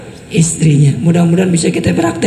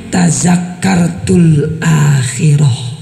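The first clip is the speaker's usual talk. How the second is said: slow tearful recitation; 5 semitones lower, much slower than usual. A narrator's reading. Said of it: "Dijawab sama Aisyah".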